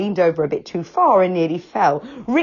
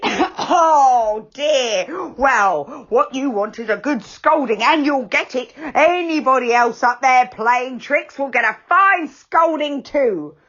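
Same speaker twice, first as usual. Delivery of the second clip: higher, louder telling-off; stern threat; 7 semitones higher, 4 dB louder, slower than usual. A narrator's reading. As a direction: promise a scolding. Angry figure threatens a scolding.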